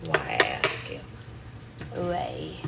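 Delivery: suddenly very low, deep mock lament; mock dramatic questioning. Streamer questioning their own French accent, mock dramatic. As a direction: drop the voice right down and plead why.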